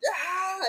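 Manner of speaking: imitated distant yell. Friend imitating the yell heard through the pool room, high contained scream. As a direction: mimic a scream carried through walls. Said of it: "we could hear him through the fucking pool room".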